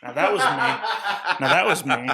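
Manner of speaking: laughing while he speaks; cracking up at the result. Real, not acted. Hearing his own synthetic voice.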